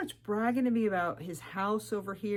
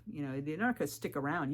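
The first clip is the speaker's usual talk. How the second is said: ordinary talk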